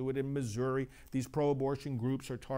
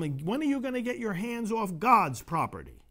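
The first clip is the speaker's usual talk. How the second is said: higher, louder retort thrown back; shouted back; 8 semitones higher, 5 dB louder than usual. Retold or imitated. Priest retelling his retort to clinic staff, loud shout. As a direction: fire it back at them. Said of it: "And I shouted back to them".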